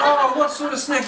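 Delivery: high, far-off doting mother; mock doting mum. Wife offering the son a snack, high mock doting. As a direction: sing it out like a mum caving in.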